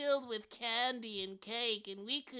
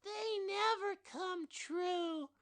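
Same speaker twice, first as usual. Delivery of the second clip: put-on disappointed child; 10 semitones higher, 4 dB quieter, slower than usual. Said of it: "cried the other child".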